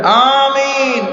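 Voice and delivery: one long drawn-out slow "Amin" called out; mock loud amin. Mocking worshippers who call amin aloud, drawn out. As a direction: stretch the amin like the congregation shouting it. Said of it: "একদল মুসল্লি জোরে কয়".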